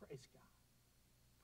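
breathless whisper of praise. Pretend. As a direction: whisper it to God. Whispered praise in quoted prayer.

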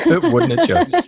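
high, slow, laughing tease; laughing playful echo. Real, not acted. Host teasing the runner about the record book, laughing. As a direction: drawl it out through a laugh.

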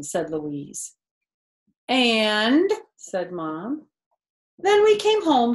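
lively storybook voice with character quotes; reading to children. A narrator's reading. Narrator reading a picture book to kids, lively storytelling. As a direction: read it aloud to a child, bright and animated.

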